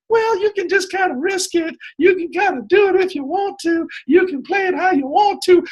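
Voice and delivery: very high-pitched put-on noncommittal voice; mock wishy-washy voice. Shown as a demonstration. Coach showing how he is not saying it, mock high wishy-washy voice. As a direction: pipe it up high, parodying zero commitment. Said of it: "I'm not saying".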